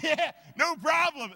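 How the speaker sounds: high, loud, slow strained casualness; fake nonchalance. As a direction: pretend it was nothing while straining. Pretending it was easy.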